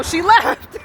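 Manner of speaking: real laugh breaking into the line. Real, not acted. Laughs at his own story.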